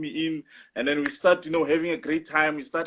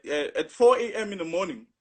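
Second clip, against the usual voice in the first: ordinary talk, nothing marked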